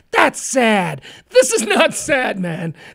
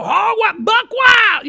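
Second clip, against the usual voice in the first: crazed; 8 semitones higher, 3 dB louder, slower than usual. Retold or imitated. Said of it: "makes them go".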